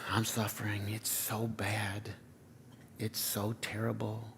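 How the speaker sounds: soft, drawn-out mock moaning, nearly whispered; milk the suffering like a martyr; self-pitying whine. Pastor mocks the congregation's self-pity with a soft, whiny moan. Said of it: "We do the math like this".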